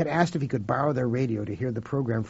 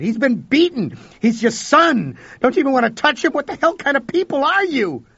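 high, loud outraged yelling; furious shout; 10 dB louder than usual. Acted. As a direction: scream at the cold parents, much louder. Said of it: "I shouted".

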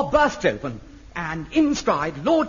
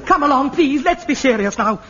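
ordinary delivery

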